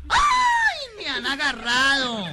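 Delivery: high, loud, drawn-out comic wail; hammed-up cry. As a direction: wail it out theatrically, stretching the words. Revive el grito del susto. (grito exagerado y alargado)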